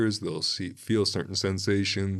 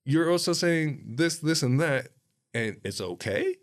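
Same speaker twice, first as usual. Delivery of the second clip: retold disbelief; 9 semitones higher, 3 dB louder than usual. Own disbelieving reaction retold.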